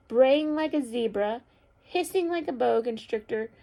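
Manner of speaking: loud, rhythmic list building to the finish; picture-book read-aloud. A narrator's reading. Teacher reads the story's ending to children, loud rhythmic storytelling. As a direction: chant out each animal line with gusto.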